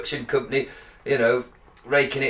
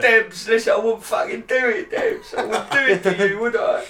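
mock chav voice; 6 semitones higher than usual. Mock guest pleading.

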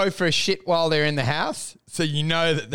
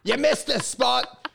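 higher shouted call across the house; mock yell; 7 semitones higher, slower than usual. Mock shouting at the cleaner after flushing. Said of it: "You go".

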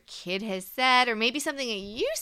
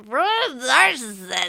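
louder, higher silly voice; mock protest; 4 semitones higher, 7 dB louder than usual. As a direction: jokingly declare it in a put-on voice. Jokey emphatic repeat for co-host.

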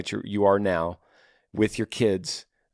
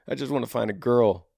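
ordinary talk

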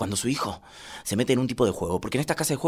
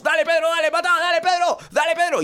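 frantic high-pitched egging-on; over-invested dad shouting at his kid's game; cheering on; 4 dB louder than usual. Acted. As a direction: yell repeated encouragement, high and insistent. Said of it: "Y lo aliento".

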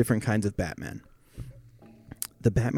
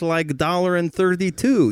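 put-on Eastern European accent; 6 semitones higher than usual. Joking with friends as an Eastern European shopper, mock accent. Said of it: "Eastern Europe".

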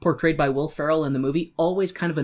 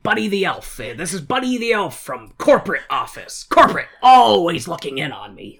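store manager impression; 3 semitones higher, 5 dB louder than usual. Shown as a demonstration. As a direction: play the fussy store manager, pushing the last line louder. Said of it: "Let me see if I can kinda do it".

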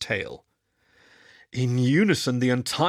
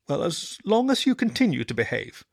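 higher, lightly amused mother; a touch of a smile; 6 semitones higher than usual. A narrator's reading. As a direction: relent with a smile. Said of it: "she smiled".

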